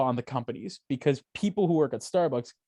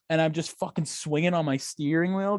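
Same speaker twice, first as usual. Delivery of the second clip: ordinary talk, nothing marked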